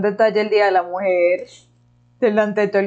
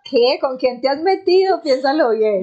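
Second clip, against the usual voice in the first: mock tough threat; 6 semitones higher than usual. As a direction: play the neighborhood tough, half-joking. (amenaza de matón en broma, más aguda)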